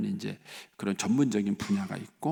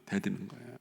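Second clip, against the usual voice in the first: ordinary talk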